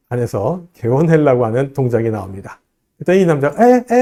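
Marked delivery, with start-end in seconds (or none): 3.6-4.0 high, loud uh-uh to stop her; a quick high warning to stop her; the man acting out stopping the drunk woman; mock warning. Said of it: "또 이게 제지하죠".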